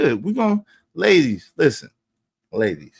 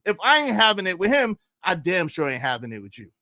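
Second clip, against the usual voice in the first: normal talking voice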